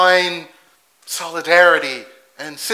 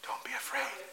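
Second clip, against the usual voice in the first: much softer, as if soothing a scared man; gentle reassurance; 5 semitones higher, 17 dB quieter than usual. Retold or imitated. Jesus calming frightened Peter, softly reassuring. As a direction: hush his fear, tenderly.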